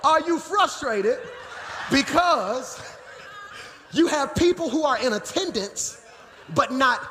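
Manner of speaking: high, slow preaching shout; drawn-out, high-pitched challenge with pauses; emphatic repeat. Real, not acted. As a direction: run it back for the crowd, punching each phrase. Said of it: "Do I need to run that back?".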